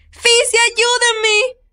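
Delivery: slow, very high, loud cry for help; terrified plea. Acted. Girl on the wobbling ladder cries for help, terrified and high. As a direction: wail it like a scared child. Gritó Mandy.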